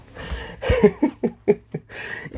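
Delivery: short breathy laugh; airy winded chuckle. Real, not acted. Laughs at own hurry.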